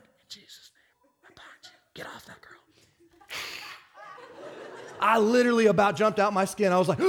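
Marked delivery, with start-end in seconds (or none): 0.0-2.6 unvoiced whisper. Preacher whispering deliverance at a handcuffed woman, whisper. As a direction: hiss the prayer so no one hears. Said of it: "I was whispering".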